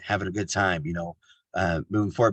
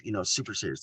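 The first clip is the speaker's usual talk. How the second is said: normal talking voice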